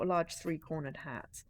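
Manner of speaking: ordinary delivery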